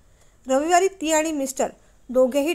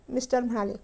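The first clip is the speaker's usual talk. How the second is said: ordinary delivery, nothing marked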